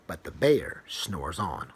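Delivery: low, soft, drawn-out refrain; sleepy refrain. A narrator's reading. Reading to a child, the bear's refrain low and hushed. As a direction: lull it down like a bedtime refrain.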